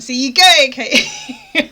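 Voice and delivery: loud, high, laughing exclamation; retold vindicated yell; triumphant outburst. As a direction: shout it as proof, breaking into laughter.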